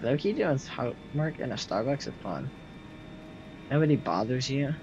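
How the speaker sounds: ordinary talk, nothing marked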